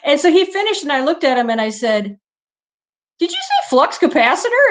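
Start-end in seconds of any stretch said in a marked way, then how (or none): 3.2-4.7 incredulous. Retold or imitated. Retold disbelief at his words.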